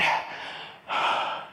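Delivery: heavy breath out, mimicking panic; acted hyperventilating. Husband sitting down after worship, acted hyperventilating sigh. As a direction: puff out an overwhelmed breath. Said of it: "I'm really hyperventilating".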